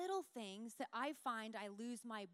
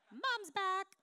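teasing husband; 8 semitones higher, 10 dB louder than usual. Retold or imitated. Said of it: "and John goes".

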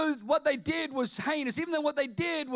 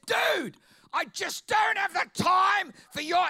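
higher, loud dismissive retort; mock brush-off; 7 semitones higher, 4 dB louder than usual. Imagined Jesus brushing off the thief, impatient. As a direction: snap him away impatiently.

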